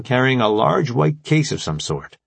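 normal talking voice

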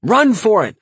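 loud urgent yell. A narrator's reading. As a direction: shout to flee. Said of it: "I yelled".

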